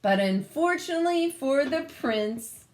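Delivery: plain reading voice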